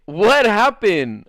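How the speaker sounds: high, loud, drawn-out question; retold astonishment. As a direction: imitate a friend stretching out her demand. Retold friend demanding the story.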